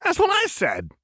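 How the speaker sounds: very high, gleeful exclamation; manic, high-pitched glee; delighted. A narrator's reading. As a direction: squeal it in triumph. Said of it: "Jinx laughed".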